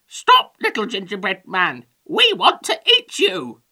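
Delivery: high, squawky hen character; chicken voice. A narrator's reading. Hungry chickens to the gingerbread man, squawking. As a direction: squawk the demand loudly like a hen. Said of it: "they said".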